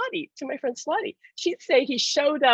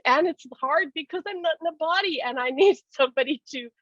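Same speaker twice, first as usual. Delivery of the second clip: laughing through the line, pitched higher; 7 semitones higher than usual. Real, not acted. Passing on Alan's wish to buy gifts, laughing. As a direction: let the laugh break into the words. Laughs while voicing Alan.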